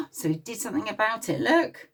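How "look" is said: ordinary delivery, nothing marked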